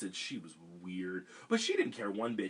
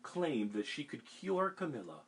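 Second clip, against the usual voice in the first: put-on reading to children; 3 semitones higher than usual. A narrator's reading. Reading picture book aloud.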